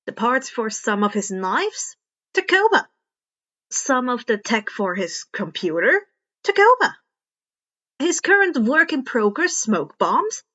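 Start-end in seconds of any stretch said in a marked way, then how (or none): 2.3-2.9 Tacoba said high and loud as a punchline; emphatic punchline. A narrator's reading. Repeated punchline answer. 6.4-7.0 Tacoba said high and loud as a punchline; emphatic punchline. A narrator's reading. Repeated punchline answer.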